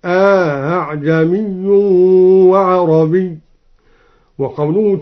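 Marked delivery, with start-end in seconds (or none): 0.1-3.4 slow recitation, أأعجمي with long aa then softened hamza; أأعجمي drawn out, second hamza eased without a hard catch. Shown as a demonstration. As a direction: stretch the first a of أأعجمي, glide into the second hamza. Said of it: "بتسهيل الهمزة الثانية مع إدخال".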